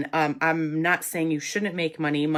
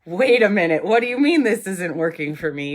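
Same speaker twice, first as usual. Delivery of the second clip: alarmed disbelief; 3 semitones higher, 8 dB louder than usual. Retold or imitated. Alarmed inner voice, retold.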